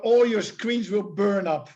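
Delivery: ordinary talk, nothing marked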